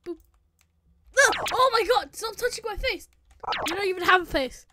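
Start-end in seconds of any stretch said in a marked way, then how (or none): 1.2-4.7 loud, high frantic cries; panicked. Acted. Waking up in a strange body, panicked. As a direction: yelp and shout in panic.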